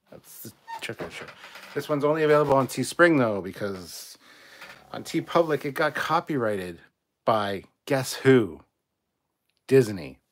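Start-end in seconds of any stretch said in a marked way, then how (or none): 1.7-3.4 fast and loud, worked up; loud rant. Real, not acted. Ranting about Disney copyright. 4.9-10.2 loud, indignant outburst; angry rant. Real, not acted. Ranting about Disney copyright.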